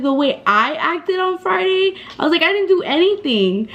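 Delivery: high, incredulous retold retort; indignant echo. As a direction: echo the accusation in mild disbelief.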